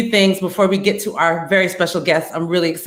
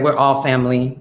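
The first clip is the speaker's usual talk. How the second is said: normal talking voice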